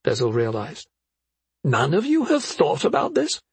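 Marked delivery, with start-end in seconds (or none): 1.6-3.4 high, disbelieving question; incredulous. A narrator's reading. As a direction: sound utterly incredulous. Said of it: "Effie sounded incredulous".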